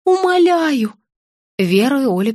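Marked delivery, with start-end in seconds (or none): 0.0-0.9 high, wailing entreaty; plaintive begging, pitched up; sobbing plea. A narrator's reading. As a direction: implore them tearfully. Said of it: "всхлипнула тётушка с пирогом".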